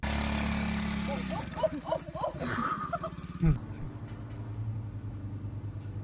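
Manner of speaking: long, airy fit of laughter; helpless wheezing laugh; breathy laugh. Real, not acted. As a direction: let it wheeze out. Reacting to a fall clip.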